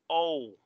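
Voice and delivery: O glided as "ou"; English letter O. Shown as a demonstration. Teacher repeating the English letter, O as "ou". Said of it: "En inglés se dice O".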